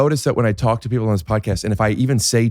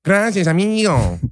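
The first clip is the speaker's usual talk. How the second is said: very high, hammed-up Mexican accent; mimicked Mexican accent; 10 semitones higher than usual. Shown as a demonstration. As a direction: badly copy a singsong Mexican accent. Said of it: "Dude, I can't do it".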